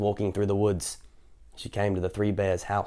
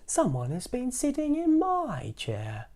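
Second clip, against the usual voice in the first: higher, slow mama bear voice; a fussy middle-sized mama bear; slow high motherly bear; 14 semitones higher, slower than usual. Acted. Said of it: "in her middle-sized voice".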